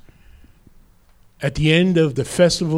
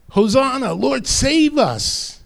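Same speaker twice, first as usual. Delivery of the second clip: high, drawn-out cry of praise; stretched, high-pitched plea to heaven; imitated proclamation; 9 semitones higher, slower than usual. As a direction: call it out high like a priest. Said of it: "because of that proclamation".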